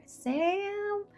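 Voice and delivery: high searching call; mother calling her lost daughter; mom calling softly. Retold or imitated. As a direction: call the child's name into the dark. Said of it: "finally you hear".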